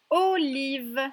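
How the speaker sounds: OLIVE loud and drawn out, vowels held; syllable by syllable. Shown as a demonstration. Teacher reading a word to find the O sound, syllable by syllable. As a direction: stretch "olive" so each syllable shows. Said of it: "J'aimerais que tu retrouves la syllabe".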